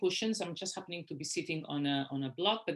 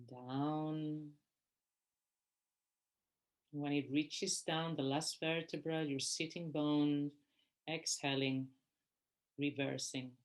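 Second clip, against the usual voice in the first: guided meditation; 3 dB quieter than usual. Real, not acted. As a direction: guide them gently through each breath, unhurried. Leads a breathing meditation.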